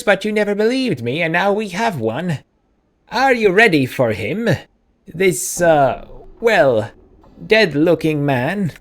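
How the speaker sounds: put-on high-pitched braying old wife; braying woman. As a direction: scold him in a braying cartoon voice. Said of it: "a diminutive woman with a braying voice".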